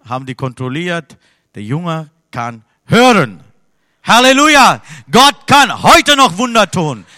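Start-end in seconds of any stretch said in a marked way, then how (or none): 1.5-7.1 Halleluja shouted high and loud, then slow and emphatic; triumphant proclaiming. Real, not acted. Preacher telling the congregation the boy can hear, triumphant. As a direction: proclaim the miracle in full triumph. Triumphant climax of miracle story.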